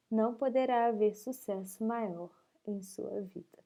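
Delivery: steady, earnest read-aloud; devotional reading. Acted. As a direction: read the book passage clearly. Reads devotional text aloud.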